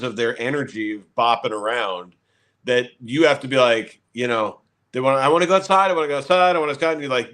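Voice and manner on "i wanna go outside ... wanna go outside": lightly voiced kid begging, slightly raised pitch; pestering kid. Kid nagging parent to go outside, mildly pestering. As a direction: nag like a restless child.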